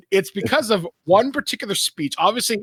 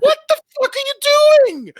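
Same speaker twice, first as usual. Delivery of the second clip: much higher, loud furious yell at the screen; angry outburst; 7 dB louder than usual. Retold or imitated. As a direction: blow up at the movie like an outraged kid. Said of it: "I got so angry and animated".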